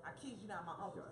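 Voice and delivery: ordinary talk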